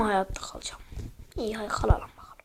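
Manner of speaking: fast tense whisper. Real, not acted. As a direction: whisper it quickly under the breath. Tense survival moment.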